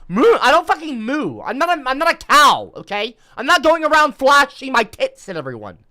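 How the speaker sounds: shouting, outraged and high; loud mock-outraged rant; loud indignant rant. Real, not acted. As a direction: yell it, offended and fed up. Angry rant at chest comment.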